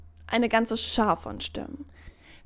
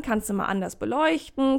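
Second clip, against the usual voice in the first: ordinary talk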